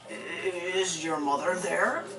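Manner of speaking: very slow, drawn-out strange caller; strange phone voice. Retold or imitated. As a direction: stretch it out like an odd caller. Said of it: "there was a strange voice".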